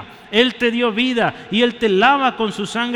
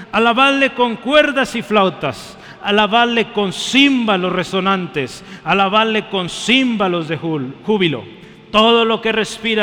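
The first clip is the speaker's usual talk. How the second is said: reading Psalm 150 aloud, louder, almost shouting each line; loud congregational psalm reading; 5 dB louder than usual. Acted. Pastor leading the church in reading the psalm, loud and fervent. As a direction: proclaim every alabadle with full force. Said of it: "¿me acompaña a leerlo?".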